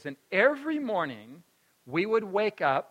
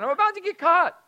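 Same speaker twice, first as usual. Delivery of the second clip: put-on anxious kid; 12 semitones higher, 7 dB louder than usual. Child pleading, about to be found.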